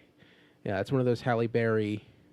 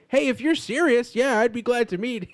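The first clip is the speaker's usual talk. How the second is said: excited quote; 9 semitones higher, 4 dB louder than usual. Retold or imitated. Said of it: "being like".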